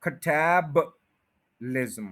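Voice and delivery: Catabolism said very slowly, टैब stressed; slow-motion catabolism; slowed model. Shown as a demonstration. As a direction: stretch out catabolism syllable by syllable. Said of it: "टैब के बाद श्वा sound है".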